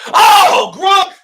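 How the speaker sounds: loud, high whoop; excited shout. Real, not acted. Gamer cheering his tight end again, loud high whoop. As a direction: yell the name out in delight. Celebrating another big play.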